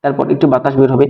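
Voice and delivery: normal talking voice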